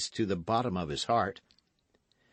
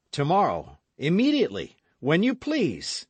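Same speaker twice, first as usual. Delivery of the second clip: eager vow; 8 semitones higher, 5 dB louder than usual. Acted. Eager vow, rising loud.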